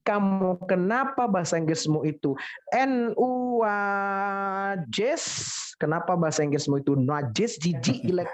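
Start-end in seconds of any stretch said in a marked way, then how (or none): 2.7-5.3 spelled out slowly. Shown as a demonstration. Teacher slowly spells the joke word for students.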